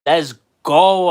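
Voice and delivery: normal talking voice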